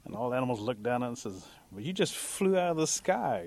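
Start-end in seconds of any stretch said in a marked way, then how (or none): 1.7-3.4 raised pitch, slow objection; mild challenge. Acted. Animals to the little flier at the feast, mildly challenging. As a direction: question the newcomer's claim, drawn out.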